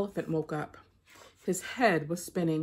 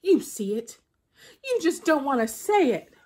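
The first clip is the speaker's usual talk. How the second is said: angry Anansi; 7 semitones higher, 5 dB louder than usual. Acted. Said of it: "Anansi stamped his legs".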